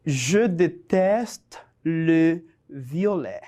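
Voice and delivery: slow, word-by-word French dictation; measured teacher's dictation with spaced words. Shown as a demonstration. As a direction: dictate it slowly for learners to write down. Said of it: "te voy a dictar cinco oraciones cortas".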